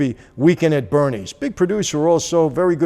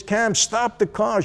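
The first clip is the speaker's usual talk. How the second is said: urgent order; 8 semitones higher, slower than usual. Retold or imitated. Urging wife to stop car.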